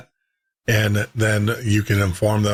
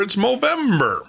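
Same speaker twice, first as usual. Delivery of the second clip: "Movember" with a high leap on "Mo"; stress on MO; 10 semitones higher than usual. Shown as a demonstration. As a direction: pitch "Movember" up sharply on the first syllable. Contrasting November with Movember.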